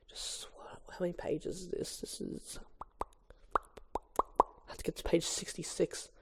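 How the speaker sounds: real near-whisper, barely voiced; muttering to self. Real, not acted. Reader checking pages left, muttering to himself. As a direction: mumble it under your breath.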